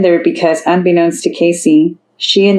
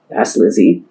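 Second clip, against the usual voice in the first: ordinary delivery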